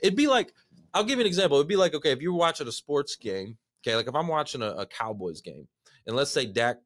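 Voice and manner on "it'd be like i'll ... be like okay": ordinary talk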